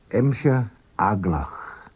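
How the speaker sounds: Emshe aglach drawn out slowly, each syllable separate; slow demo. Shown as a demonstration. Teacher to learners, saying Emshe aglach slowly first. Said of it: "First, slowly".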